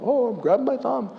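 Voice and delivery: high, lightly voiced eager listener; mock fan. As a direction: chirp it like a proud convert. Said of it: "I have people come up".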